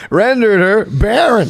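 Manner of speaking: high, slow mock proclamation; hammed-up, drawn-out emphasis; all capital letters. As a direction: read it out as if in capitals. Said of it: "All capital letters".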